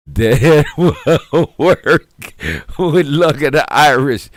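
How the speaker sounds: laughing. Real, not acted. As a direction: laugh through the words at bad luck. Laughing at own luck.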